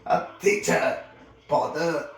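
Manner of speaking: loud outraged repeat; roaring reprimand; angry roar. A narrator's reading. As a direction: bellow it in indignation. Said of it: "roared at Harry".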